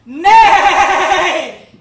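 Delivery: very long, loud quavering neigh; full-blast horse whinny. Acted. Horse told to speak again, full-blast whinny. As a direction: stretch the neigh out at full volume.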